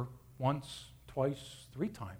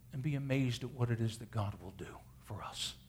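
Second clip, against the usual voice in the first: hushed; 5 semitones lower, 6 dB quieter than usual. Acted. Preacher drops to awed hush.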